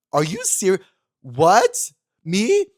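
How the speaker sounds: high, loud gasping questions; shocked disbelief. Real, not acted. Stunned at being mistaken for another singer, shouted disbelief. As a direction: blurt it out stunned.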